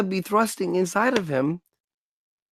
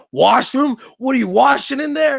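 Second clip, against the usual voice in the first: mocking Americans; 8 semitones higher, 5 dB louder than usual. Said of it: "and everyone's like".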